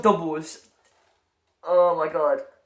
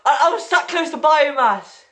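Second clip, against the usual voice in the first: excited near-miss; 8 semitones higher than usual. Real, not acted. Near miss opening crates.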